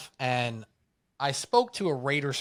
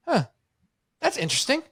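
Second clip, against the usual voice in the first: mock surprise; 11 semitones higher, faster than usual. Mocking the fan's claim.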